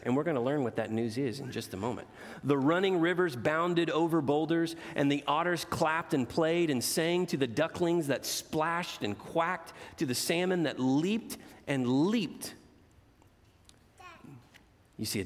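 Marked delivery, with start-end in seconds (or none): 2.4-12.5 put-on expressive read-aloud to children; gentle storyteller voice for kids; storybook reading. A narrator's reading. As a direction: read it like a bedtime picture book. Reads picture book aloud.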